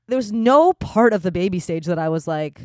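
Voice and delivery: ordinary talk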